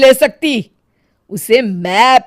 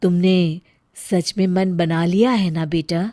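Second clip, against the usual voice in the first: soft, low, distant consoling voice; soothing ghost; 4 semitones lower, 5 dB quieter than usual. Acted. Mother's spirit consoling her weeping daughter, softly. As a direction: comfort her gently from beyond.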